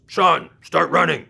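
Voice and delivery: slow, loud barked order; a big coach shouting a punishment; coach yelling. Retold or imitated. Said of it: "and he yells".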